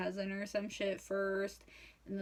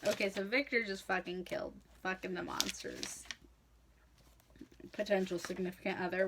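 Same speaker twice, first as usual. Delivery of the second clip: ordinary talk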